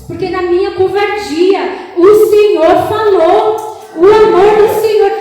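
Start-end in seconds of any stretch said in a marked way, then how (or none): 2.0-5.1 loud, high, drawn-out proclamation; impassioned preaching. Real, not acted. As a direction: lift the words up slowly. Preaching peak. (proclamação lenta e alta)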